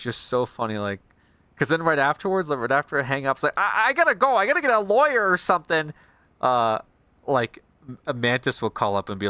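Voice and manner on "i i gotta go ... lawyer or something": high, loud panicked rush; panicky villain. Retold or imitated. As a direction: blurt it out in comic panic. Puzzler after hanging up.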